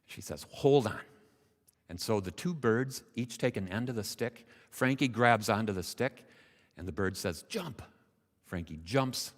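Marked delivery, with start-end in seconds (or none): 0.5-1.0 loud, raised instruction; commanding. Acted. Mother swallow readies the frog for take-off, commanding. 7.4-7.8 high, quick command; urgent cue. Acted. Bird cues the frog to leap, high command. Bird gives take-off order.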